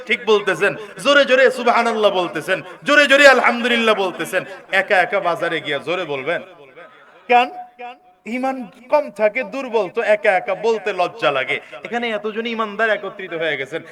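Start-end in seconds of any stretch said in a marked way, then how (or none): none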